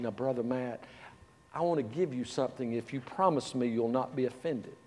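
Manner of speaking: softly proper, gently correcting; very polite and proper. Retold or imitated. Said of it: "very polite, very proper".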